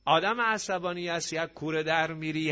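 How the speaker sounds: ordinary talk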